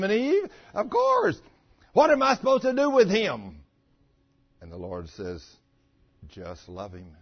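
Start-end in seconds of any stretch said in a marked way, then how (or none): 1.9-3.6 high, loud exasperated plea; mock fussing, pitched up; wife fussing. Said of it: "And she's fussing". 6.3-7.2 low, very soft voice; imitated calm divine murmur; the Lord's hushed reply. The Lord's gentle answer, acted.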